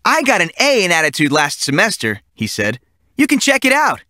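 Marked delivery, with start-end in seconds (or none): 0.0-2.2 put-on cheeky boy. A narrator's reading. Boy goofing on teacher. 3.2-4.0 put-on cheeky boy. A narrator's reading. Boy goofing on teacher.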